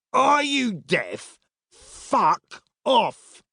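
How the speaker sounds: slow, high-pitched angry bark; angry dismissal. A narrator's reading. Centurion ordering two tired travellers away, angry shout. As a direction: bellow it slowly as to the deaf.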